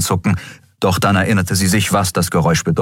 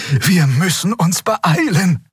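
urging; 11 semitones higher, slower than usual. A narrator's reading. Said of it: "mahnte Xea".

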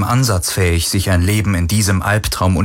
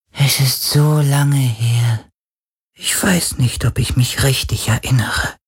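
slow, airy spirit voice, higher; an ancient spirit struggling to remember; ghostly voice; 6 semitones higher than usual. Acted. Ghost voice hesitates.

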